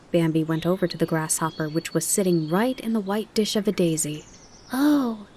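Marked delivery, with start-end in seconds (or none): 4.7-5.3 a polite, lightly raised oh; polite young fawn. A narrator's reading. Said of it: "said Bambi politely".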